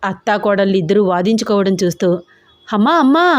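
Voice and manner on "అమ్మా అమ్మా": high, raised call to stop the quarrel. A narrator's reading. Daughter calling out over quarrel.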